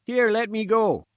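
high, loud sputtering protest; struggling in the gatekeeper's grip. A narrator's reading. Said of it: "he sputtered".

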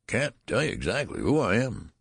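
put-on high, wavering old man's whine; querulous whine. A narrator's reading. Old newborn answering his father, querulous whine. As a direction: whine it with pitch swooping up and down. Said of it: "replied the querulous whine".

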